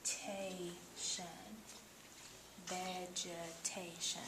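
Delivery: "Vegetation" said slowly and softly, every syllable clear; slow model. Shown as a demonstration. Tutor showing a student how to say the word, slow and soft. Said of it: "look at it while you say it".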